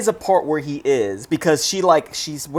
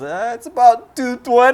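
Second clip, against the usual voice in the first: acted quote; 9 semitones higher, 5 dB louder than usual. Retelling Mick's bluff about the time.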